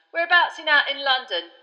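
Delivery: high, loud cheery bus conductor; brash, cheerful young conductor calling out; Stan voice. A narrator's reading. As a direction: belt it out like a chirpy Cockney conductor. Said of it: "said Stan".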